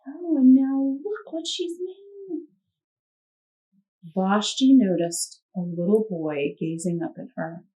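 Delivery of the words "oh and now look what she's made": plain reading voice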